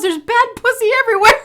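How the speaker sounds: high, loud, fast mock of the husband; wife imitating husband's disgusted line at the fridge, loud and high. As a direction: blurt it out, disgusted, like him. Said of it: "I have to mock him so you guys know".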